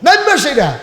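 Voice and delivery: high-pitched bus-stop call; acted calling out. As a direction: call out like someone getting off a bus. Bus passenger calls to get off.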